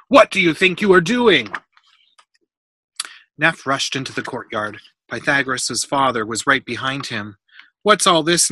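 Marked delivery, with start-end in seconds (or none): 0.1-1.5 loud, high-pitched scolding outburst; angry demand. A narrator's reading. Builder catching a boy in the mess, angry demand. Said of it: "Suddenly a voice demanded". 7.8-8.5 loud, higher irritated question; snapped. A narrator's reading. Builder finding tiles everywhere, snapped demand. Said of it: "Neff snapped".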